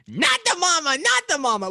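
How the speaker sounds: put-on high squeaky dinosaur baby, Not the mama; Baby Sinclair voice. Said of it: "making Baby go".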